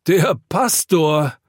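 high, loud, drawn-out startled call. A narrator's reading. Drinker recognises the pastor at the door, startled call. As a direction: call out slowly, high and half shocked. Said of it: "ruft er halb lachend, halb erschrocken".

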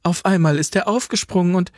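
worried granddaughter rushing her words; excited, flustered. Acted. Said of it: "sagte die Enkelin aufgeregt".

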